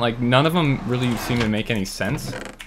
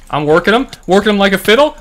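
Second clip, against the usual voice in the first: loud, high, cocky crowing; real gleeful bragging shout; gleeful gloat; 8 semitones higher, 10 dB louder, faster than usual. Real, not acted. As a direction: crow over the win. Gloating over winning a fight.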